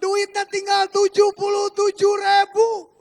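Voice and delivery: loud, high, drawn-out wail; hammed-up pleading yell, stretched slowly; crying out to God. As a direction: re-enact a desperate shouted prayer. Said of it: "Saya cuman ngagorowok ke Tuhan, teriak sama Tuhan".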